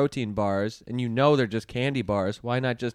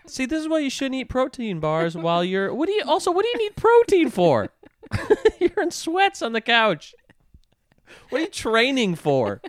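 teasing; 9 semitones higher, 3 dB louder than usual. Real, not acted. Teasing partner eating protein bar.

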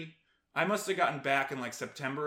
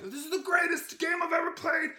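excited exclamation; 13 semitones higher, 3 dB louder than usual. Retold or imitated. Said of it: "I'd have, like".

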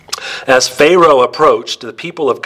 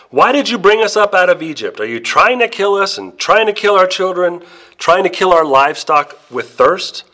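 higher, accusing whine at Moses; indignant complaint; 6 semitones higher than usual. Acted. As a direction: demand answers like a fed-up crowd. Israelites complain to Moses again.

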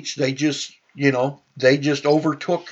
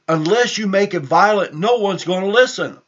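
higher, insistent imitated agitator; raised, emphatic urging; urgent warning; 6 semitones higher, 4 dB louder than usual. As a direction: press it on them like someone stirring up a crowd. Voicing agitators urging the crowd.

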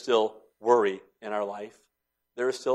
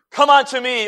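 high, loud, ringing call; ringing preached call; 14 semitones higher, 10 dB louder than usual. Acted. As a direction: proclaim it over the congregation. Preached quote of Christ.

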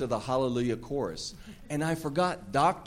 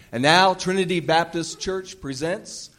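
smooth polished announcement; 6 semitones higher, 4 dB louder than usual. Acted. Announces the play.

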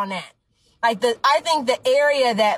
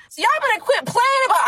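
calling out; 12 semitones higher than usual. Real, not acted. Calling out the audience.

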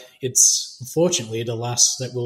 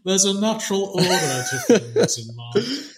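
a hint of a smug, silky tiger villain, higher; Shere Khan impression; 6 semitones higher than usual. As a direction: purr it out like a lordly tiger. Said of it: "very much like Shere Khan".

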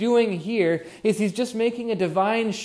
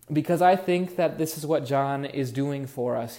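ordinary delivery

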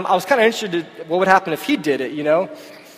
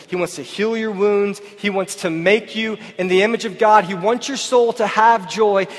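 preaching at full force; 3 semitones higher than usual. Acted. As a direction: preach it to the congregation with conviction. Preaching to the church.

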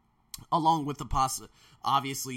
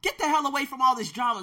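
usual speaking voice

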